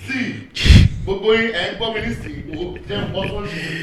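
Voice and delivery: half-joking lover's threat in Yoruba; mock threat to a cheater. Warning his partner not to cheat, mock threatening.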